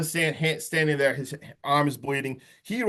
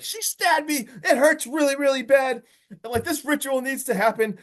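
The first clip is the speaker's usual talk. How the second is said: high, strained mock complaint from the stabbed cult guy; pissed Gil; 10 semitones higher than usual. Stabbed Gil complaining to the cult, pitched high and upset. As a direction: whine it up high like a wounded villain. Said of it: "Gil is pissed".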